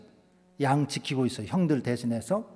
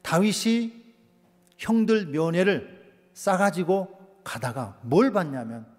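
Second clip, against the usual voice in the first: ordinary delivery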